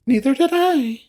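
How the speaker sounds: put-on high, bubbly girlish chirp; chirpy agreement. Feline agrees about skipping a shower, chirpy. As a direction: chirp it high, delighted to share.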